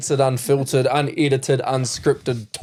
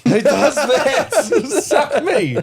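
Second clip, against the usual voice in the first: laughing disbelief; 9 semitones higher, 3 dB louder, much slower than usual. Real, not acted.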